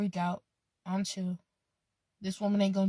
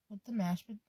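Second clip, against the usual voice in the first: ordinary talk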